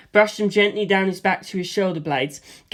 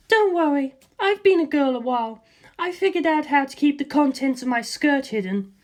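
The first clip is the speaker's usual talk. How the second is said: put-on higher breezy young woman's voice; Lisa Lisa; 8 semitones higher than usual. Lisa Lisa reassuring George about her skirt, higher girlish voice. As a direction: toss off reassurance lightly, in a higher girl's voice.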